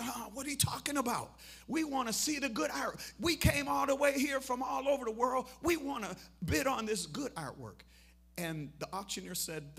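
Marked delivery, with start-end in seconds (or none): 0.0-7.8 loud, high-pitched outraged complaint; indignant crowd protest. Retold or imitated. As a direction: protest loudly as a cheated, angry bidder. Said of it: "the people who were grumbling, complaining".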